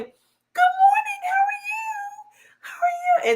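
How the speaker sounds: very high syrupy greeting; mock the phony office greeter; fake polite voice. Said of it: "the women that come in".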